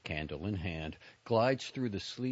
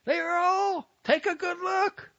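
shouting; 11 dB louder than usual. Acted. Zippa shows spirits on stick.

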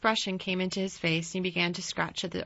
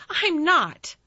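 short, high, loud denial; Colin, curt; 10 semitones higher, 8 dB louder than usual. A narrator's reading. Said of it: "said Colin shortly".

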